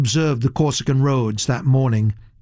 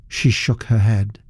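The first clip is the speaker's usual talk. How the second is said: ordinary delivery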